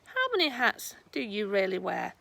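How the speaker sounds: plain reading voice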